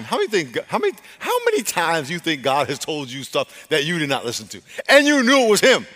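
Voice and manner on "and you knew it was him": high, loud incredulous rebuke; confronting shout. Real, not acted. Preacher chiding those who ignored God's warning, confronting shout. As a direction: hit them with the accusation.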